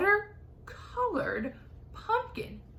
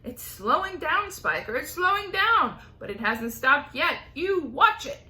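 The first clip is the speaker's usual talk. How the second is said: loud, higher bickering; bickering aunts; 4 semitones higher, 5 dB louder than usual. Acted. Two aunts bickering about the peach. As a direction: argue it back and forth.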